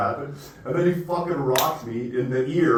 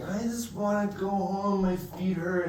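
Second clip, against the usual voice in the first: put-on much higher, slower whiny girly voice; mock her complaining she wants home; higher mock drunk girl whine; whiny girlfriend; 8 semitones higher, 4 dB quieter, slower than usual.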